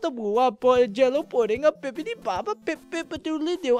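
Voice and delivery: high, goofy nonsense babbling; badly faked comedy voice; silly put-on voice. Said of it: "This is why I don't do voices".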